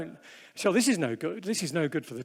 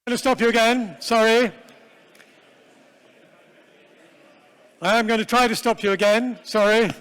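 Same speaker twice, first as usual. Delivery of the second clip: raised, higher voice calling over chatting groups; calling out to stop the room; 4 semitones higher, 7 dB louder than usual. Real, not acted. Speaker interrupting group discussion, raised louder call. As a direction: call the room back to attention, apologetic.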